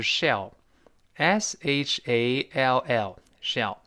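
slow, letter by letter; spelled out slowly. Shown as a demonstration. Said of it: "特别重要的情态动词". (慢速逐个字母拼读)

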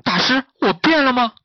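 high, loud nervous question; touch his face, panicking. Acted. Pig asking master if his face changed, nervous. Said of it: "紧张道".

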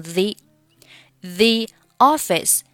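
the said as thee before office, slow. Shown as a demonstration. Teacher showing thee before office. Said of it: "前面的the可以读成the".

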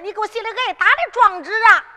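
ordinary delivery, nothing marked

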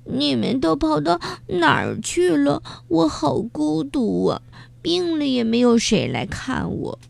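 high, mildly tearful whimper; a lonely beetle half-crying to friends; tearful complaint. Acted. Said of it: "哭着说".

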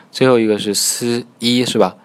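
usual speaking voice

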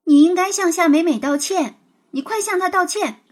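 put-on girl scolding the boy, stern and loud. A narrator's reading. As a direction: demand an apology sharply. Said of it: "牛妞批评雷天龙".

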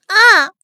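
sharp high cry of pain; shriek of pain. Acted. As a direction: scream out as a plate hits the wrist. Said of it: "徐婉欣惨叫一声".

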